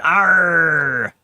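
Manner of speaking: loud growled pirate "Arr". Pretend. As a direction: shout it like a salty pirate. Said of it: "we missed Talk Like a Pirate Day".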